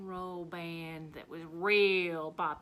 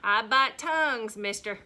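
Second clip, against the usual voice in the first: sassy warning; 10 dB louder than usual. Retold or imitated. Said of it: "I told Butch first date".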